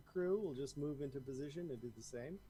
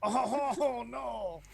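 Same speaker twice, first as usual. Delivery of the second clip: put-on French accent; 8 semitones higher, 10 dB louder than usual. GM reacts to French creatures.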